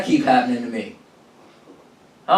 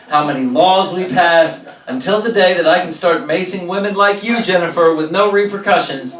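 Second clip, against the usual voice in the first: mock rant; 4 semitones higher, 4 dB louder than usual. Comic telling the crowd, hammed-up oration. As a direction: preach it like a crusade.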